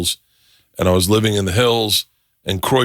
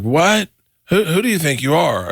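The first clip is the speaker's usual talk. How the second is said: higher, affronted mock outrage; retold indignation; 6 semitones higher than usual. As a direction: imitate an offended boss on the phone. Retold booker offended by refusal.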